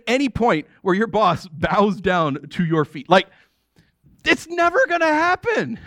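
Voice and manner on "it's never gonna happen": it's never gonna happen, pitched high and incredulous; laughing disbelief, high and bright. Real, not acted. To the congregation, incredulous and pitched high. Emphatic exclamation.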